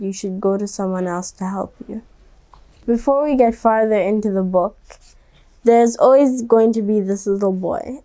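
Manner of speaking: usual speaking voice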